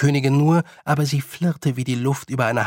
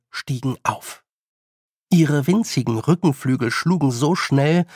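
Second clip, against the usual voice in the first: plain reading voice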